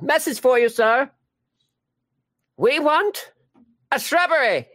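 high, loud mock Monty Python voice; high-pitched comic quote; silly movie quote voice. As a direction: squeak out the line like a comic knight. Repeating Monty Python lines.

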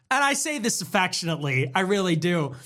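usual speaking voice

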